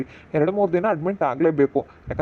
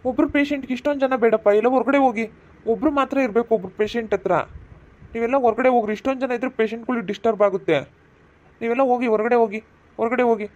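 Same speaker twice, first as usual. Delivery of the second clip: higher, stern doctor's voice sending people out; 5 semitones higher than usual. Acted. Doctor ordering the crying family out of the ward, stern. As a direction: shoo the crowd out like an irritated doctor.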